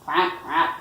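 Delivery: loud, short imitation quacks; duck call. A narrator's reading. Reader voices the duck in a picture book, quacking. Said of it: "called the duck".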